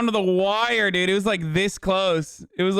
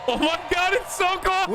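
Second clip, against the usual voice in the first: excited; 10 semitones higher than usual. Real, not acted. Streamer thrilled by the rally.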